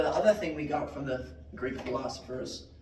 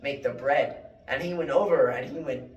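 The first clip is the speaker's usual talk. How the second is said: ordinary talk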